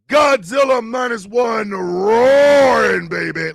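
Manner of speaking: loud drawn-out announcer roar; hype call. Real, not acted. Host hyping the show, slow loud announcer. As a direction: stretch it like a ring announcer.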